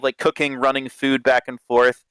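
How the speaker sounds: ordinary talk, nothing marked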